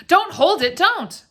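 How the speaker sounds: high, loud frightened protest; alarmed plea. A narrator's reading. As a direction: beg him urgently to stop. Wife alarmed by the veil.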